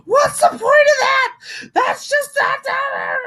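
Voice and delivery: high, frantic whine of self-blame; whiny self-blaming self. Retold or imitated. To her therapist, mock self-blaming whine pitched high. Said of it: "You know what I sound like".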